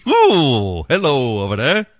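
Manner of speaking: high, playful ooh hello; flirty ooh. Pretend. Host pretends to flirt with the recording, high playful hello. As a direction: act flirty at the voice. Reacting to sexy French voice.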